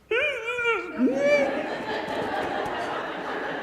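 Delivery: loud burst of high laughter; laughing hard at own story; big laugh. Real, not acted. Speaker laughs at his own punchline, loud laughter.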